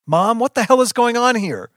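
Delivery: high, loud demand; frantic yell. A narrator's reading. Child confronts mother at the fire, yelling. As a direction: demand an answer from Mom at the top of your voice.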